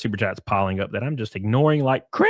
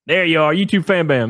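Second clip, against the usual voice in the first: calling out; 6 semitones higher, 6 dB louder than usual. Real, not acted. Spots chatter, calls out.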